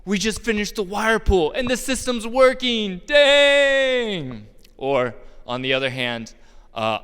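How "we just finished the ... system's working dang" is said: excited coworker. Retold or imitated. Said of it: "Somebody will come up to me".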